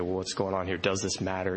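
normal talking voice

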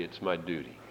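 flat, soft dutiful reply; dutiful deadpan. Retold or imitated. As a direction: say it like a chore, very low and soft. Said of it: "And I say".

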